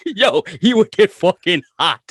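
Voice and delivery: laughing through the words, high and loud. Real, not acted. Host recalling how his friend got mad, laughing through it. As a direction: crack up while telling it.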